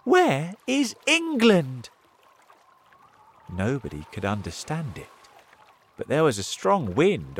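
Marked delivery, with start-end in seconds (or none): 0.0-1.9 high, slow, loud question; bewildered cry. A narrator's reading. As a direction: call out in dismay. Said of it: "they cried".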